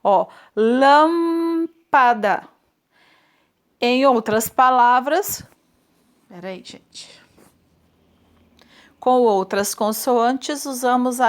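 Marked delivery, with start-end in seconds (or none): 0.5-2.5 lâmpada drawn out slowly, lips closing on the m; teacher stretches lâmpada to show the closed M; louder, slow lâmpada for the class; slow demo word. Shown as a demonstration. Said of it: "a gente fecha a boca pra falar". 6.3-7.2 whispered aside to the class; real whispered interruption. Real, not acted. Teacher aside during recorded lesson.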